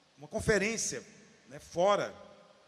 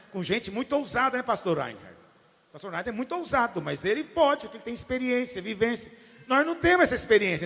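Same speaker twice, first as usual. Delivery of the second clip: ordinary talk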